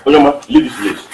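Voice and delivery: loud, heated command; angry order. Acted. Man sending away the meddler who insulted him, angry order. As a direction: order them out forcefully.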